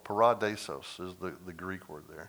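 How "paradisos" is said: "Paradisos" stressed on the second syllable, pa-RA-di-sos. Shown as a demonstration. Preacher naming the Greek word, second-syllable stress on "Paradisos". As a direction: punch the RA in "Paradisos". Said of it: "It's the second syllable".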